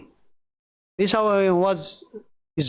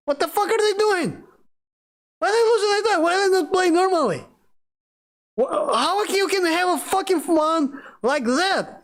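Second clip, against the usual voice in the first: much higher, worked-up disbelief; exasperated rant; 8 semitones higher than usual. Real, not acted. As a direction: rant about the players, pitched high and heated. Ranting about childish players.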